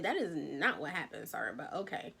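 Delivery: low, very soft aside; muted disbelief. Retold or imitated. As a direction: say it under your breath, trailing down. Muted retold aside to a liar.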